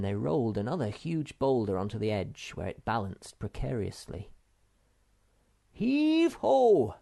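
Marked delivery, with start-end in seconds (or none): 5.7-7.0 high, louder hauling cry; rousing work shout. A narrator's reading. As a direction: call out as you heave the rock. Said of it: "shouted Snufkin".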